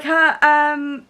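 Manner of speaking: ordinary talk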